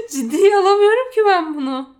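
can barely speak for laughing. Real, not acted. Laughing at a silly photo. (gülerek konuşma)

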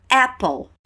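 "Apple" said as EH-pol, stress on the first syllable; clear model word. Shown as a demonstration. Teacher showing students how to say Apple. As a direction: model "Apple" with an open EH sound. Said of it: "como pronunciar".

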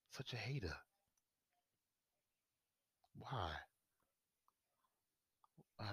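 soft whispered why. Real, not acted. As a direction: breathe the question. Hushed mock-hurt question.

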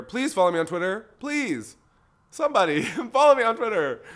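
pleading. Real, not acted. Begging listeners for follows.

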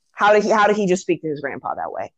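ordinary talk

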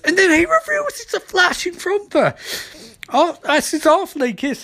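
high, squealing rush of fan excitement; giddy, high-pitched storytelling; excited reveal. Real, not acted. Excited recap of big reveal.

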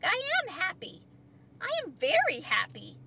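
high, happy insistence; cheerful Frog. A narrator's reading. Frog tells Toad nothing is wrong, cheerfully insistent.

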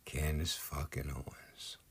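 scornful whisper. Real, not acted. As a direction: hiss the name under your breath. Contemptuous aside naming a commentator.

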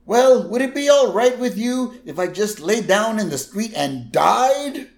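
high mock old man complaint; imitating grandpa's self-pity; lightly voiced cranky old man; old man whine. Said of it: "Abe's like".

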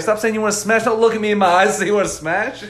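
put-on telling the kid off in exasperation; exasperated scolding. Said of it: "He's like".

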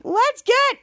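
high, loud chant lead; rallying call. Retold or imitated. Henry leading the kids' chant, rallying call. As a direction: call it out for the team to answer. Coach leads a team chant.

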